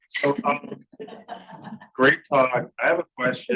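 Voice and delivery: speaking like a soldier on parade; military voice. Acted. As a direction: address the room like an officer. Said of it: "I'll use my military voice".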